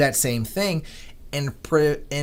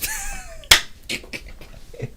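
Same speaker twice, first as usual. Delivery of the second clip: delighted laugh; 5 dB quieter than usual. Real, not acted. Trader laughs after a big win.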